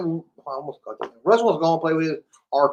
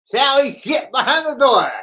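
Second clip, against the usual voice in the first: tongue sticking out; 9 semitones higher, 5 dB louder than usual. Shown as a demonstration. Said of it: "I used to hold my tongue and say".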